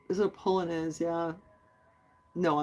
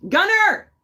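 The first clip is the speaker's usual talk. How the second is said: loud, high-pitched call; 8 dB louder than usual. Real, not acted. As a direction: holler for someone in another room. Calls Gunner over from elsewhere.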